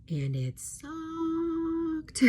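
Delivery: and it sucked, stretched very slow and high; milk the word sucked with exaggerated disgust; dramatic whiny drawl of annoyance; drawn-out disgust. Real, not acted. Drawn-out complaint about phone update.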